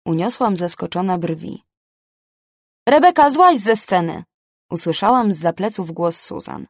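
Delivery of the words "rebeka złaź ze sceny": called out from behind, higher and louder; calling out. Acted. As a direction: yell her off the stage. Boss calls her off stage.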